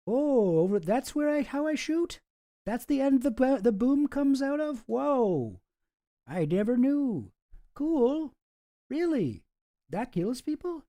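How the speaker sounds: high, wide-eyed dopey voice, amazed by everything; clueless gun guy. Acted. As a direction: play him as a naive goon. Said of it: "my little one-act play there of starring those two mysterious guys".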